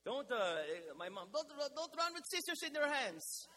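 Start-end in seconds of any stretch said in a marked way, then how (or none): 1.3-3.5 high, loud nagging mom voice; shrill-edged parental warning, hammed up; imitating his mom. Pastor imitating his mother warning him about scissors, high and loud. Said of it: "That's how she sounds".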